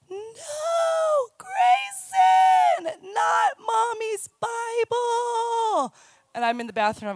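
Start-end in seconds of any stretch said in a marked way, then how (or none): 0.1-5.9 high, shrieking, stretched-out wail; child's anguished cry. Retold or imitated. Little daughter screaming at her brother, high anguished wail. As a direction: cry out like a five-year-old watching her treasure torn. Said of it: "But I hear her go".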